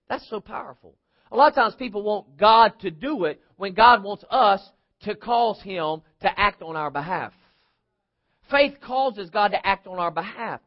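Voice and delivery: ordinary delivery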